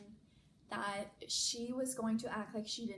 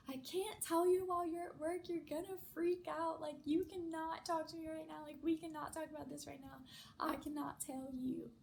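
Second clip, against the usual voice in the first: retold bawling, higher-pitched pleading; tearful protest; 7 semitones higher than usual. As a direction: imitate wailing through tears, voice pitched up. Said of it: "I started just bawling my eyes out".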